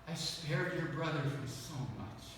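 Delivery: quiet consoling promise; tender voice of the Lord. Retold or imitated. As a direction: press "spared" gently home as God. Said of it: "I sensed the Lord say this to me".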